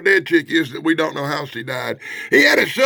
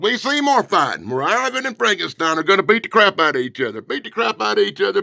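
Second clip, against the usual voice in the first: ordinary talk, nothing marked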